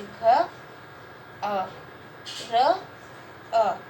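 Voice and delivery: घ, अ, र, अ said as separate sounds with pauses; sounding out. Shown as a demonstration. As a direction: spell out घर sound by sound for a class. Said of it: "चार ध्वनियाँ आ रही है".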